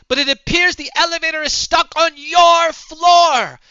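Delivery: sustained high, full-voiced shout; yelling up the shaft. [name] explaining the stuck elevator from below, yelling. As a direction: holler every phrase so it carries far. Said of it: "is somehow is yelling from the elevator shaft".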